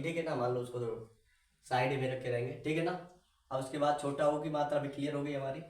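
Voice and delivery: ordinary talk, nothing marked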